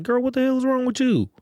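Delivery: put-on high, sharp scolding of a girlfriend; scolding a woman. Man voicing a woman scolding her friend in public, high and sharp.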